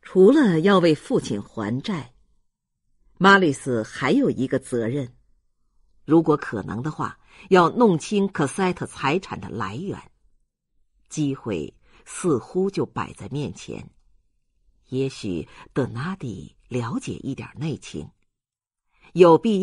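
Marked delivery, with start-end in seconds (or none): none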